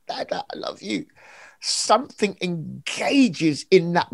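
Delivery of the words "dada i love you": breathy whisper, tiny and soft; whispered baby words. Acted. A baby whispering love to its parents, whispered. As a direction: whisper it like a little child. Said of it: "like a baby cries out".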